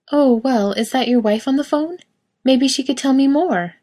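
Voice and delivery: cheerful shop assistant; bright saleswoman. A narrator's reading. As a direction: reassure the lost customer brightly. Saleswoman voiced in story.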